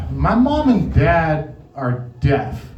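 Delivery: normal talking voice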